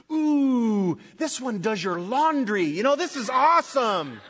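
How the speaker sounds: hammed-up delight over a new phone; mock gushing. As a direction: gush like a besotted shopper.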